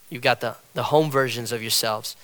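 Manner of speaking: ordinary talk, nothing marked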